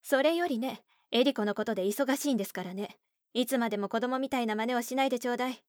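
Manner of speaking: irritated mother. Acted. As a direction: snap at her impatiently. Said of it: "腹立たしそうに言った".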